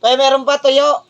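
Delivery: loud call to a neighbour. Real, not acted. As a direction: call across the yard. Calling out to a buyer.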